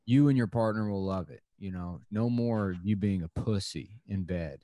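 ordinary delivery

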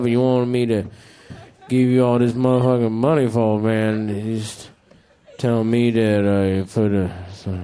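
slump into a burnt-out comic's low mumble; Doctor Pepper's set. Retold or imitated. Comedian doing his friend's act in a deep mumble. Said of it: "I'm gonna do his set verbatim".

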